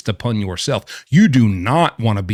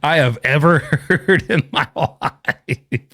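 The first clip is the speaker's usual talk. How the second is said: real disbelieving laugh; 4 semitones higher, slower than usual. Real, not acted. Laughing at absurd claim.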